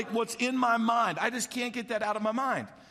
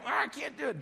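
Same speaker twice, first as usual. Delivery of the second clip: mock tantrum; 6 semitones higher, 4 dB quieter than usual. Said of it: "I might throw a fit".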